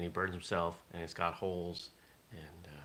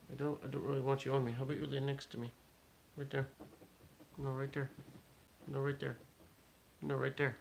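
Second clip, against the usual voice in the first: talking to a pet; 6 semitones higher, 4 dB quieter than usual. Real, not acted. Coaxing a pet to lie down.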